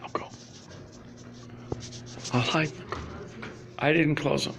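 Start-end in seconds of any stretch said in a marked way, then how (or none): none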